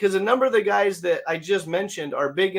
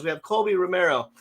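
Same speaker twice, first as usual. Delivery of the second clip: ordinary delivery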